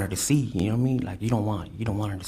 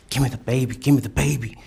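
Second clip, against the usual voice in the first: louder, higher insistence; urgent retold demand; 4 semitones higher, 7 dB louder than usual. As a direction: press her to hand it over. Retold argument over baby.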